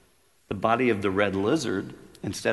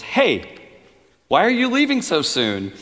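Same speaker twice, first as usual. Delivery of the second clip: much higher and louder, calling after him; 11 semitones higher, 11 dB louder than usual. A narrator's reading. Angel calls after the ghost as he leaves, calling out. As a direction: call to him across the distance. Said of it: "The angel calls and says".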